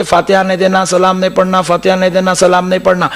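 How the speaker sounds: ordinary talk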